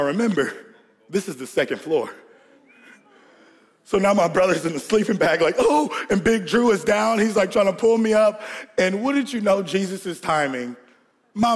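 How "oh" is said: high comic wail; mock distress. Brother in sleeping bag after the crash, mock distress. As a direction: imitate a hurt kid crying out high.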